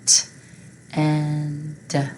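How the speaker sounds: each chunk said apart and loud; sounded out by parts. Shown as a demonstration. Teacher sounding out a word for the class. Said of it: "Let's spell it".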